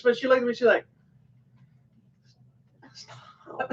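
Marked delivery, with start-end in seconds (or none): 2.9-3.7 barely audible imitated whisper; mouthed whisper. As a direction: mimic someone whispering under their breath. Retelling her silent reaction to him.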